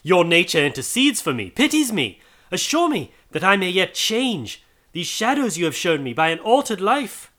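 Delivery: high, tearful pleading; imploring. A narrator's reading. Scrooge on the ground begging the spirit, high plea. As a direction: beg for mercy from the ground.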